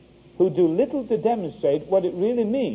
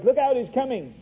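retold yell; 7 semitones higher than usual. Cockney kid warns the princes as police approach, mock yell. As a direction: imitate a shouted warning without full volume. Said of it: "yelled at the top of his voice".

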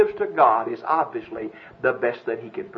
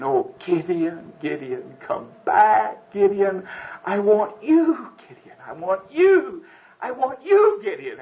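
sobbing angel; 5 semitones higher, 3 dB louder, slower than usual. Acted. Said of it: "sobbing out his sorrow".

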